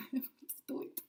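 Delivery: a breathy self-mocking whisper; whispered aside. Real, not acted. Woman joking to herself beside the pool, whispered. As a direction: whisper it to yourself with a little laugh.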